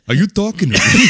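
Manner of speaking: high, loud incredulous challenge through laughter; hammed-up confrontation, cracking up; mock offended tough guy. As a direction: playfully shout an indignant challenge. Imagined offended Italian market reply.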